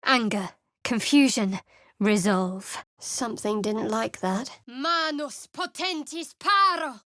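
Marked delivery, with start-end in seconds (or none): 4.7-7.0 higher, ringing battle chant; spell incantation. Acted. Cleric casting mid-battle, ringing incantation. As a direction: call the spell out with force.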